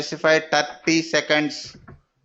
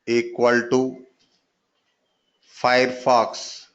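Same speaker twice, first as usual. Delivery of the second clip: dictating. Shown as a demonstration. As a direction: dictate each token for students to copy. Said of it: "teacher dictating code line".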